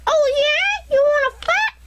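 very high squeaky challenge. Acted. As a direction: squeak out a dare.

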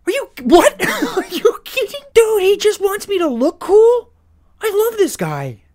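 can't believe the gift; thrilled disbelief. Real, not acted. Stranger gives rare items.

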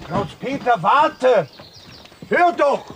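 loud, high call to someone leaving; calling after him. Real, not acted. Director calling after the frog actor walking out. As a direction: shout after him to stop.

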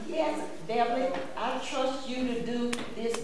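a hint of God's voice, reassuring.

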